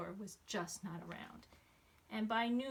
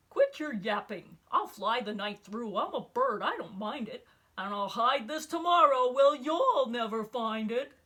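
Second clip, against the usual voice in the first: much louder brash bird voice, calling back over its shoulder; loud taunting eagle; 2 semitones higher, 11 dB louder than usual. Acted. Eagle calling back to Horton while flying off, loud gloating. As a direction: bellow it across the sky, gloating. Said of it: "shoulder called back".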